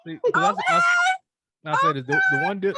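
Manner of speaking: very high, loud worked-up cry; squealing, fired-up interruption; excited outburst. Real, not acted. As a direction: burst in to make her point.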